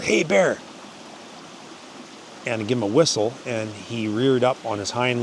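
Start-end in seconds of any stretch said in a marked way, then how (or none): 0.0-0.6 yelled at a bear. Retold or imitated. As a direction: shout it up high to a grizzly. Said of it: "I yelled".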